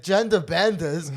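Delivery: loud, high, drawn-out chant; gleeful catchphrase. Retold or imitated. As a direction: crow it slowly, relishing each word. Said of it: "That's all I said".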